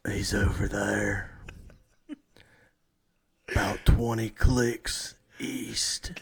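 slow, loud stage whisper; jungle tracker. Acted. Host plays tracker locating his prey, slow forceful whisper. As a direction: hoarsely whisper it like a tracker on the hunt.

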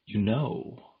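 sly coaxing creature voice; dark whisper behind you. Acted. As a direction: wheedle it, half laughing. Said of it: "a voice that had a coax and a wheedle and a sly laugh".

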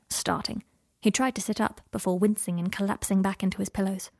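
plain reading voice

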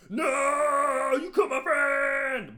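high, drawn-out melodramatic shout; Bruce Wayne. Acted. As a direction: wail in hammed-up anguish. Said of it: "Bruce Wayne's just like".

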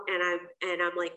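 usual speaking voice

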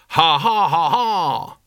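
loud, booming battle laugh; bellowing laugh. A narrator's reading. Cohen laughs at the army before the charge, booming laugh. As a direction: roar with laughter at the enemy. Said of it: "he bellowed".

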